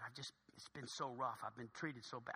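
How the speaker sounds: low, very soft lament; hushed confiding. Acted. Gate agent telling of her rough night, hushed confiding.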